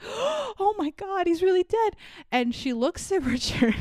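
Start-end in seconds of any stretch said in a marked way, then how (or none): 0.0-2.0 shocked gasp. Retold or imitated. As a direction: gasp it out in horror.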